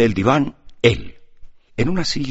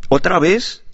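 higher, louder scolding question; reproachful; 11 semitones higher, 6 dB louder, slower than usual. A narrator's reading. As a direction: scold him for crying again. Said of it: "dijo Gruchinka con tono de reproche". (con tono de reproche)

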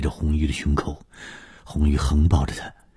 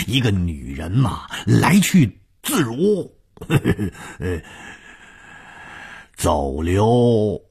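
light-hearted, chuckling; 5 semitones higher than usual. A narrator's reading. 武刚 dismissing worry about the old man, amused and light. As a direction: toss it off airily, laughing between phrases. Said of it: "轻松地说".

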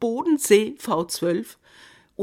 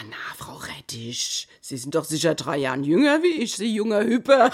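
ordinary talk

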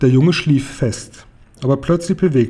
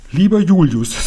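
mild stern mother, higher and louder; streng; 5 semitones higher, 5 dB louder than usual. A narrator's reading. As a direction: address the husband sternly. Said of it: "sagte Konrads Mutter streng". (strenge Mutter, nachdrücklich)

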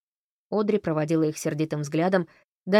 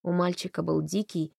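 ordinary delivery

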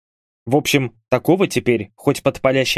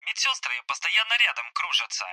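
soft, higher, thin phone-filtered voice; voice on speakerphone; 6 semitones higher, 8 dB quieter than usual. Acted. Hospitalised soldier reporting to his comrades, telephone voice. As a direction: talk as if coming through a phone speaker. Bars heard over speakerphone.

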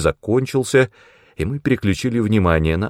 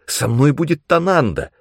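higher, insistent protest; pitched-up youngster's rebuttal; objecting; 7 semitones higher than usual. A narrator's reading. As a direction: argue back eagerly. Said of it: "возразил я".

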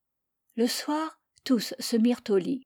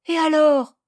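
put-on high, loud pleading little boy; child's voice; 7 semitones higher, 9 dB louder than usual. Boy begging his parents to spare the lamb, high and loud. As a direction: plead like a small boy defending his pet. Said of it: "objecta Karim".

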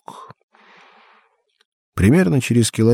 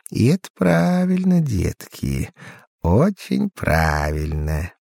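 insinuating rustling voice; 2 semitones higher, slower than usual. A narrator's reading. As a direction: coax it like rustling leaves. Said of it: "вкрадчивый, как шелест листвы, голос".

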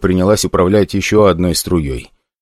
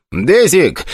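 higher, urgent shout of the dog's name; 9 semitones higher than usual. Acted. Igor shouts to his hellhound to attack, urgent call. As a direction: call the dog sharply, pitched up. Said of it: "кричал Игорь".

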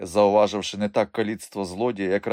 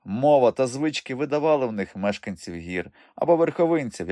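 ordinary delivery

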